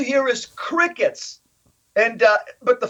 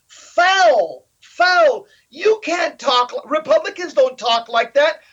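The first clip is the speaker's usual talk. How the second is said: higher, indignant calling foul; mock protest; 5 semitones higher than usual. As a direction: cry foul like outraged opponents. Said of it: "and they're saying".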